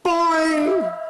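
high, loud boing into an echo mic. Real, not acted. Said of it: "That's my echo mic".

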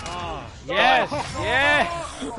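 loud, laughing yes; victory cheer. Real, not acted. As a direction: celebrate the win out loud. Celebrating the win.

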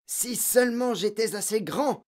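put-on mild boyish growl; grumbling. A narrator's reading. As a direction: grumble like a resentful boy. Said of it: "grogna Victor".

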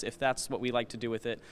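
ordinary delivery

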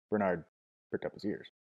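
ordinary delivery

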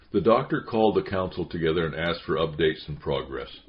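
plain reading voice

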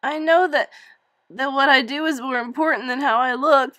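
high, loud crying plea; tearful plea. Acted. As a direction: plead through tears. Crying girl in mother's arms.

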